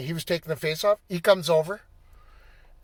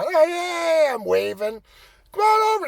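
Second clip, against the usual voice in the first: calling out; 10 semitones higher than usual. Retold or imitated. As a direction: wave him over. Calling the referee over.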